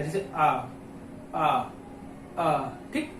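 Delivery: आ low and drawn out; middle vowel demo. Shown as a demonstration. Teacher modelling the middle vowel आ, low. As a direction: let आ come from the middle of the mouth. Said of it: "बीच से आवाज आए".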